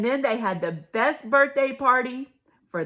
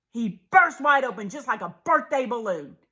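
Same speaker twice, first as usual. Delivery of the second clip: plain reading voice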